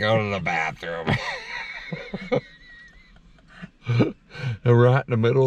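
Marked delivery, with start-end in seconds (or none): 1.1-2.4 squeaky high laugh; high giggle. Real, not acted. Laughing at bathroom need.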